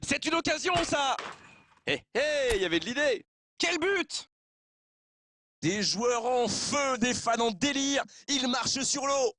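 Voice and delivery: high, shouted goal call; excited sports commentary. Acted. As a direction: celebrate the goal at the top of the voice.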